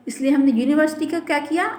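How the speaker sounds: ordinary talk, nothing marked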